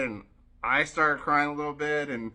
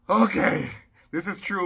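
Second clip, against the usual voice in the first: exasperated compliance; 8 semitones higher, 9 dB louder than usual. Retold or imitated. Acted reluctant giving in.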